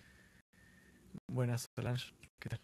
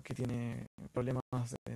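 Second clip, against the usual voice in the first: normal talking voice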